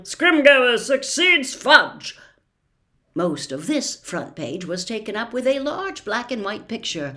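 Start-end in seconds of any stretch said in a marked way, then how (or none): 0.0-2.2 louder, slow headline read; announced headline. A narrator's reading. Narrator reads the second newspaper headline loud and slow. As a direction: proclaim it like a banner. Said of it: "This one bore the headline".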